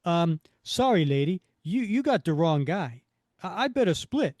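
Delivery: loud, awkward Brooklyn-style brush-off; Roscoe. Acted. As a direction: back out of it in a broad Brooklyn accent. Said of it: "I am not giving him this accent".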